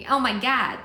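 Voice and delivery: acted storybook outburst; Lucy, exclaiming. Shown as a demonstration. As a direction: demonstrate a character's shocked line. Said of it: "it's easier when it's like".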